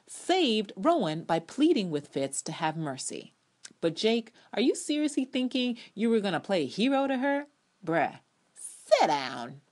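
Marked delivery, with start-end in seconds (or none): 3.8-7.4 high, loud disbelieving question; incredulous callout. Real, not acted. Calling out a character. 7.8-9.6 slow, drawn-out put-down; dismissive. Real, not acted. Dismissing character.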